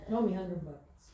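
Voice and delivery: low-pitched mock son voice; son asking for money. Mother imitating son texting for a loan, low mock voice. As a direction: imitate your son's low, casual request. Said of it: "that's how he talks".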